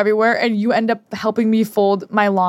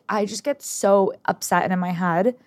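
usual speaking voice

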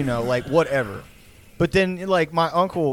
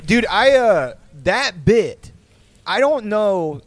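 normal talking voice

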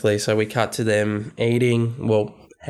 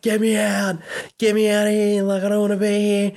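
high, desperate, pleading to be let out; frantic high-pitched begging; panicked plea; 9 semitones higher, faster than usual. Retold or imitated. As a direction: beg to escape in a panic. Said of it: "Wallace is like".

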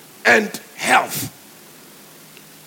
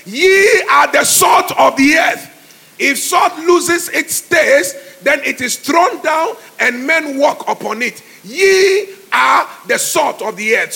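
scripture declaimed; 2 semitones higher, 6 dB louder than usual. Real, not acted. Preacher declaims Jesus's words to the congregation, loud. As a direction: proclaim the verse with full force.

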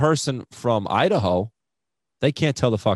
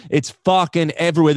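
ordinary delivery

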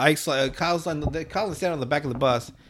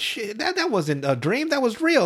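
put-on excited kid voice; 8 semitones higher, slower than usual. Kids voiced in a story.

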